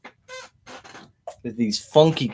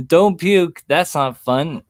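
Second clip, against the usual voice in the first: playful warning; 6 semitones higher, slower than usual. Real, not acted. Joking warning to chatter.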